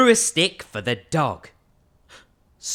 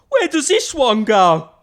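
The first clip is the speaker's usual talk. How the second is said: louder, higher, baffled; puzzled big skeleton; 7 semitones higher, 8 dB louder than usual. Acted. Big skeleton holding a stray bone, baffled voice. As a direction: wonder aloud where the bone fits.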